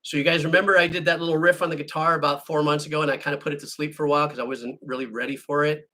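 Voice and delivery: ordinary talk, nothing marked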